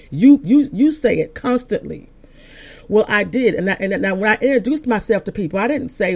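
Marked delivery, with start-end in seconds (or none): 0.1-2.1 YOU punched high and loud, repeatedly; urgent charge, leaning hard on "you"; insistent command. Retold or imitated. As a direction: hammer each "you" higher and louder. Retold command from the Lord, insistent.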